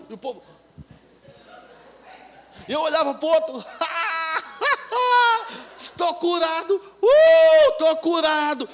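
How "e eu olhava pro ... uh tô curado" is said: high, loud laughing cry; ecstatic shout. Retold or imitated. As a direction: laugh and shout the healing to strangers. Shouting healed at other drivers. (grito eufórico entre risadas)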